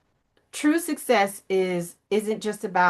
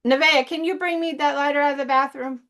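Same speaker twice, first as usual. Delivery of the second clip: higher, sustained call to someone away; 6 semitones higher, faster than usual. Real, not acted. Asking Nevaeh to bring the lighter, calling out. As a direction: call across to another room.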